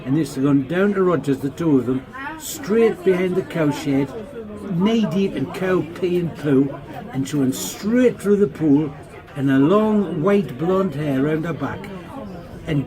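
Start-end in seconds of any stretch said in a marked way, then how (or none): none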